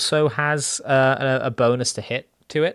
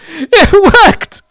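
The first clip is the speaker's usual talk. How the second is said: high, loud amazed cry; amazed shout; 16 dB louder than usual. Real, not acted. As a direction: cry out in disbelief it worked. Amazed the trick worked.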